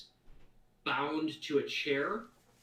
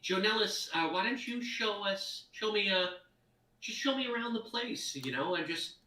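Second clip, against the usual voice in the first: friendly NPC voice; 6 semitones higher than usual. Acted. NPC greets the party warmly.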